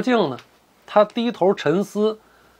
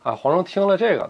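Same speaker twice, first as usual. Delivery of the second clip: plain reading voice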